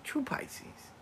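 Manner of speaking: high breathy whisper aside; knowing whisper. Real, not acted. Grandma confiding zodiac trait, whispered. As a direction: let them in on the secret.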